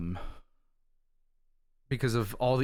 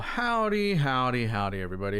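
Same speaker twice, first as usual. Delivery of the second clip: country drawl. Pretend. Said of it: "don't know why I said it like that".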